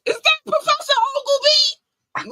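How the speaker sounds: high, loud, shocked squeal; Cat Williams impression. Comedian's bit quoted to a friend, high squeal. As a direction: shriek the name in disbelief. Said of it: "Cat Williams said it best".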